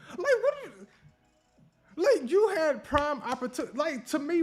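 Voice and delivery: high-pitched, laughing disbelief; incredulous. Real, not acted. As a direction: squeal it in amused exasperation. Mocks women's comments, laughing in disbelief.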